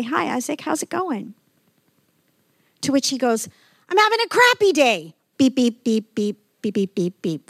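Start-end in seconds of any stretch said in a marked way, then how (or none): 0.0-1.3 friendly greeting. Retold or imitated. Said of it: "stop you and say". 3.9-5.1 much higher, louder bitter complaint; angry outburst. Retold or imitated. Isaac telling the pastor his bad day, higher and louder. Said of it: "so angry". 5.4-7.5 imitated bleep censor beeps. Pastor censoring Isaac's rant with mock censor beeps. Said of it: "filtered version".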